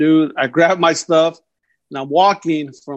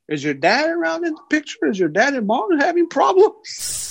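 lightly voiced concerned school staff, much higher; retold probing questioner; 11 semitones higher than usual. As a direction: imitate a delicate question, pitched up and stretched.

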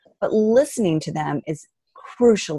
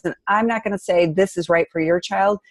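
ordinary delivery

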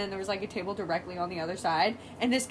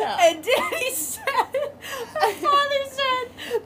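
high, slow, laughing almost to tears; helpless laughter; 5 dB louder, slower than usual. Real, not acted. Sister quoting father's joke, cracking up. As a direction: struggle to get the punchline out.

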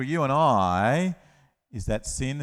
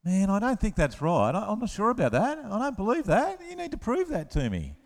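lightly voiced skeptical Thomas, higher; a hint of a skeptic, pitched up; doubting Thomas; 9 semitones higher than usual. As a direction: play a doubtful disciple who wants proof. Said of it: "he's always".

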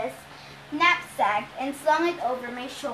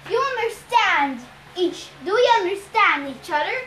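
loud, menacing growl; 4 semitones higher, 5 dB louder than usual. A narrator's reading. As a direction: growl the warning. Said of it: "Ares growled".

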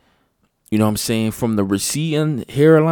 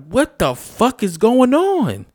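higher, slow, stunned outcry; shocked disbelief; 7 semitones higher, 3 dB louder, slower than usual. Retold or imitated. Reacting to news of child suicides, slow stunned disbelief. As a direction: spell out your disbelief. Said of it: "I'm like".

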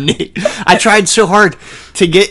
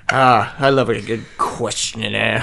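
ordinary talk

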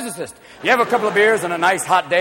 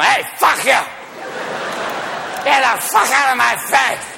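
put-on belligerent drunk; 5 semitones higher than usual. Sweet person after whiskey shots, shouting belligerently. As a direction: lash out at whoever is nearest.

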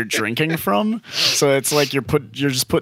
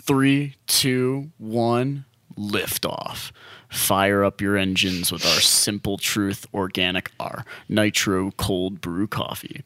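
label read like a commercial; 5 semitones lower, 4 dB quieter than usual. Acted. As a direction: read it like a product ad. Reads the can label aloud.

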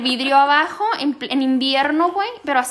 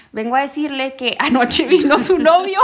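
laughing retelling; 3 dB louder than usual. Retold or imitated. Friend retelling a neighbour's news about the boyfriend, laughing. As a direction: quote broken up by laughter.

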